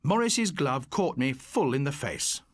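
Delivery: ordinary delivery, nothing marked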